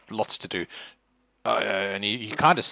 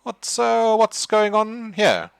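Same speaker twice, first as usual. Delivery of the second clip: pompous man, rising higher and louder; stern host; 5 semitones higher, 8 dB louder, slower than usual. Acted. Winfield questioning James, pompous and rising loud. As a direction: demand answers, climbing up in pitch. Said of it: "he's like".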